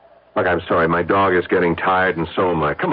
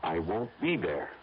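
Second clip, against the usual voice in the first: hushed slow refusal; 10 dB quieter than usual. Acted. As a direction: say it quietly and slowly, no give.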